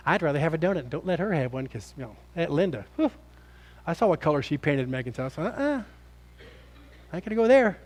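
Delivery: soft, low gossiping aside; gossipy aside. Pretend. Church gossip whispering about Aunt Linda, soft and low. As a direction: dish the dirt under your breath.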